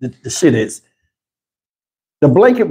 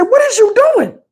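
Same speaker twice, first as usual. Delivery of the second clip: high, baffled what is you doing; incredulous. Retold or imitated. A man stunned at his wife cleaning the remote, high disbelief. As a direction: squeal it in disbelief at the wiping.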